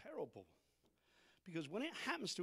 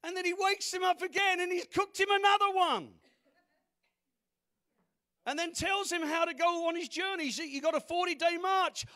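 high, shouted sermon delivery; full-force preaching; 11 semitones higher, 13 dB louder than usual. Real, not acted. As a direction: belt it out to the congregation, high and loud.

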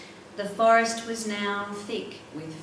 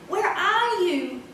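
high, drawn-out call from far away; distant call; 9 semitones higher, 4 dB louder, slower than usual. Acted. Edgar searching for Angeline, calling out from a distance. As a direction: shout into the fog to find her.

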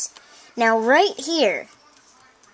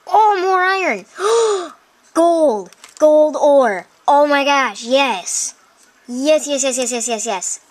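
high, loud gasping thrill; excited discovery; 4 semitones higher, 5 dB louder, slower than usual. Real, not acted. Player spots more iron and gold, thrilled. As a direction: exclaim each find loudly, with a gasp.

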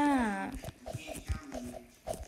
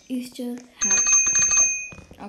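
stretched out; 9 dB louder, much slower than usual. Real, not acted.

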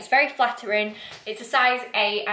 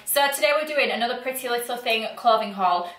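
YouTuber intro; 2 semitones higher than usual. Acted. Sisters opening their haul video, upbeat YouTuber intro. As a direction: greet the viewers with energy.

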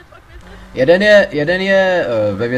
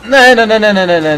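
louder, higher run of desperate nos; frantic nos; 6 semitones higher, 10 dB louder than usual. Real, not acted. Gamer cornered by police, loud frantic nos. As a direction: plead with the game. Cops closing in again.

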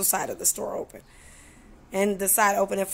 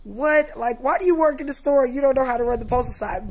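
mock outrage, pitch jumping higher and louder; retold angry outburst; 4 semitones higher, 4 dB louder than usual. Retelling her outburst at the store clerk, loud and indignant. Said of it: "I said".

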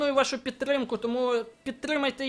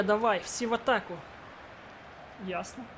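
ordinary talk, nothing marked

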